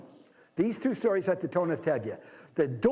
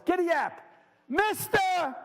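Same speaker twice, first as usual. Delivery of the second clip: acted yell on a raised pitch; mock yell; 11 semitones higher, slower than usual. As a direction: call out high as if hailing from a distance. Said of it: "I yell at the horse".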